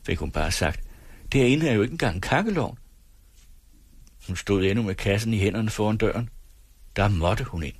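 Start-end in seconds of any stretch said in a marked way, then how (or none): 1.3-2.8 higher-pitched, sing-song protest; voiced complaint, pitch swinging high. A narrator's reading. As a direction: object with rising exasperation. Wife's complaint voiced in story.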